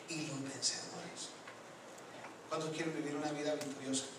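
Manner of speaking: soft, low preaching with long pauses; calm closing exhortation. Real, not acted. Preacher closing his sermon to the church, hushed reassurance.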